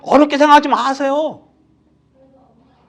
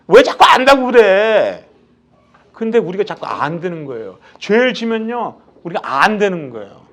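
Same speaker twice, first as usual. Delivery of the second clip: ordinary talk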